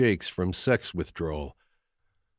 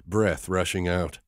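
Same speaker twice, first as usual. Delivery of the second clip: ordinary delivery, nothing marked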